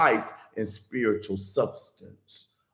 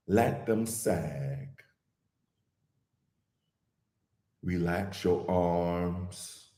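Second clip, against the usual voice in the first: guided meditation; 6 semitones lower than usual. Real, not acted. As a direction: ease listeners gently into calm, unhurried and even.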